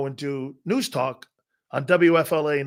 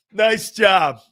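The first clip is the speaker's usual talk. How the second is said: louder laughing reprise of the line; laughing repeat; 3 semitones higher, 7 dB louder than usual. Real, not acted. Retelling the holiday dig to listeners, laughing. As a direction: laugh it out loud, delighted. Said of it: "Nice job, Dad".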